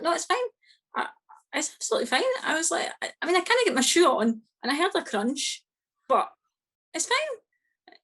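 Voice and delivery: high, airy insistence that all is fine; high brushing-off denial. Retold or imitated. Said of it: "And I'm like".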